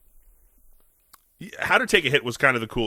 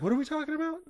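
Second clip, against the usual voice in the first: suddenly oblivious; 7 dB quieter, much faster than usual. Retold or imitated. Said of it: "And he's like".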